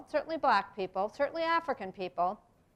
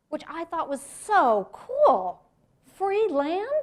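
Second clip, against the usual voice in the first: higher and louder, slow, gleeful; childlike delight; 8 semitones higher, 8 dB louder, much slower than usual. Retold or imitated. Recalling family's free land, higher gleeful excitement. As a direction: savor the wonder of free land.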